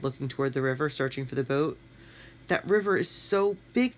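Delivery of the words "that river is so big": moaning. A narrator's reading. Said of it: "Tony moaned".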